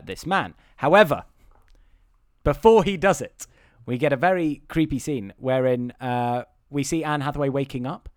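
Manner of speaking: normal talking voice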